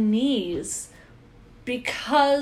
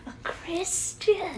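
slow whisper of a secret; 7 semitones higher, 6 dB quieter, slower than usual. Real, not acted. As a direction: whisper it so nobody hears. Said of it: "What was that?".

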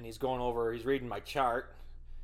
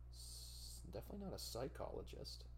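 much softer, fast, breathy muttered correction; whispered aside; 16 dB quieter than usual. Real, not acted. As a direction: say it under your breath to yourself. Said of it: "self-correcting aside".

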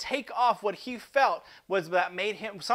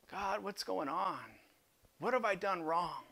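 much softer, lower, bewildered prayer; hushed plea; 3 semitones lower, 9 dB quieter than usual. Acted. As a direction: murmur the question to God. Quiet acted prayer.

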